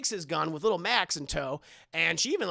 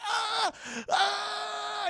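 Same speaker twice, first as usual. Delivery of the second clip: screamed much higher in pain; 14 semitones higher than usual. Retold or imitated. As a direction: shriek as if your leg just snapped. Girl breaks her leg.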